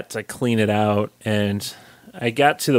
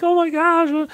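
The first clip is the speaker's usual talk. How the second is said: mock tearful squeal. Retelling tearful moment, high squeal.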